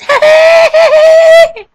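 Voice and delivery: put-on high shrieking old lady cackle; granny cackle. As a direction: cackle loud like a wicked granny. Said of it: "granny impression".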